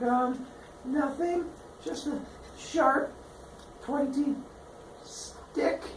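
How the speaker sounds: slow, high, halting and soft; mock-sheepish cover-up; evasive. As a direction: dole out the words reluctantly, with pauses. Son evasively hides weapon.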